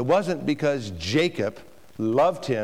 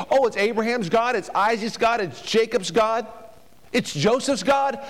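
preaching at full force; 7 semitones higher than usual. Acted. Preacher urging parents to teach children, at full force. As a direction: drive each name home to the congregation.

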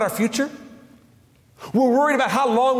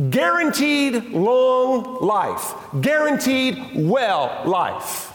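slow sign reading; much slower than usual. Retold or imitated. Mock sign on the spa.

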